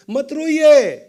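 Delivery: high, loud command; acted loud, high plea; urgent son. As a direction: call it out to stop him crying. Son stops father's crying.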